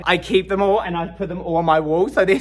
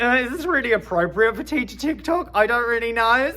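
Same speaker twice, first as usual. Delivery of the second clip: higher, hesitant self-questioning voice; mock doubtful; 7 semitones higher than usual. Wondering whether a joke fits teacher TikTok, mock doubtful. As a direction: put on an unsure, raised voice.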